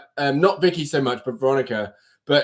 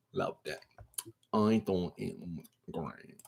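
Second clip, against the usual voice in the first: embarrassed mumble; 11 semitones lower, 18 dB quieter than usual. Real, not acted. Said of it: "not even gonna shame myself".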